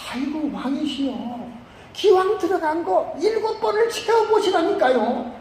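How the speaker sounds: high, drawn-out coaxing; pleading. Acted. Aide begging the general to finish seven dips, pleading. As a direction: implore the master in a slow, high-pitched voice.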